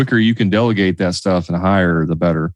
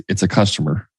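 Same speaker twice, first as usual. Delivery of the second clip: ordinary delivery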